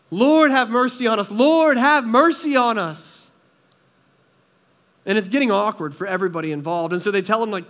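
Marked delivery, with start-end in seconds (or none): 0.1-3.3 loud pleading cry for mercy; beggars screaming out. Retold or imitated. Blind beggars crying out to Jesus, loud desperate plea. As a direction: call out desperately, begging loud. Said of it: "They just start screaming out".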